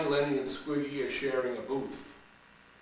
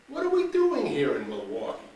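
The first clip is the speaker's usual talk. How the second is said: Shirley's eager question; 5 semitones higher, 7 dB louder than usual. Acted. Shirley's first take.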